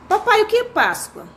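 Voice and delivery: lightly acted child, high-pitched question; filho perguntando. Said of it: "entre um pai e um filho". (filho perguntando ao pai, voz aguda)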